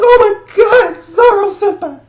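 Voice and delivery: very high, loud squeal; hammed-up falsetto swoon; mock tearful fanboy. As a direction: cry out like an overwhelmed fan. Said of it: "I'm about to cry".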